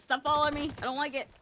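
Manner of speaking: high, loud flustered complaint; panicked protest. Pretend. Player chased by so many enemies, panicked protest. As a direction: protest as the swarm closes in.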